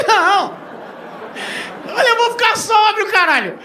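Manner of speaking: grito agudo e alto; desespero cômico. Retold or imitated. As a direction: protesta em pânico exagerado. Desespero com voo longo. (berro desesperado e engraçado)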